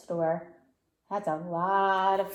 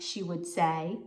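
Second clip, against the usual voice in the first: plain reading voice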